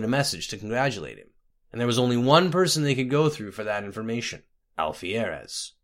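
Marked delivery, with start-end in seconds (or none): none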